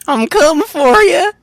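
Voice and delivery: high, slow country twang; creepy country twang, very high and slow. Pretend. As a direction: drawl it out in a hammed-up country twang. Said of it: "Can we get the country twang in there".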